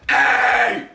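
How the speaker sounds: loud harsh rasping hey; pushed growl. Shown as a demonstration. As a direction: push it on with grit. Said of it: "and then you can push it on".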